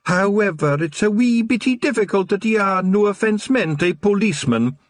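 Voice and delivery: high, teasing Scots brogue; amused Scottish pixie. A narrator's reading. Rob Anybody teasing Wee Mad Arthur, high amused Scots. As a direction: rib him like a cheeky big brother.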